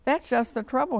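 high, mildly cross little voice; a touch of storybook grumpiness, high; cross bunny. A narrator's reading. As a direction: complain crossly, pitched well up. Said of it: "wiggled his ears crossly".

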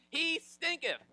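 very high, loud comic exclamation; hammed-up relish. Preacher loves the KJV version, hammed-up relish. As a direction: play up the old-fashioned verb.

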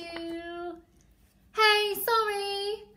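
high, loud, playful call; calling out apology. Acted. Teacher acting the apology to the elephant, calling out. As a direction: call sorry across to the elephant.

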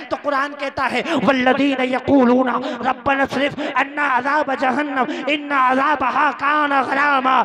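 projected scripture recitation, slowing at the end; impassioned preaching. Acted. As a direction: recite firmly, then ring out the warning. (जोशीली तक़रीर)

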